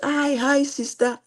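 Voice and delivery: high, loud mock hello; acted-out cheery greeting; imitated friendly greeting. Acts out the person's greeting.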